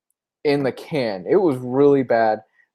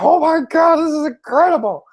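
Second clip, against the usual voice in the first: much higher, excited exclamation; amazed gush; 13 semitones higher, 3 dB louder than usual. Retold or imitated. Fan recalling first reading, gasping in high excitement. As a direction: gush like a fan who can't believe it. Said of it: "I was like".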